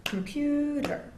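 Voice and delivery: comPUter, PU long and high, said slowly; length and pitch stressed. Shown as a demonstration. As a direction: stretch and raise the stressed syllable. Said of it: "you go diagonally".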